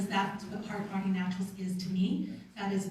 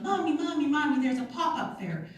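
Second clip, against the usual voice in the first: put-on higher, louder eager child calling; excited kids; 5 semitones higher, 5 dB louder than usual. Kids call to mom about the pop-up fair, excited.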